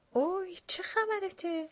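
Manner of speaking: indignant, what's wrong with you; irritated. A narrator's reading. Ani snapping at Chakavak for pushing, irritated. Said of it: "یه نگاه قیزدار بهش کرد و گفت".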